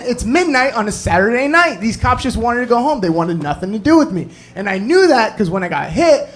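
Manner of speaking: normal talking voice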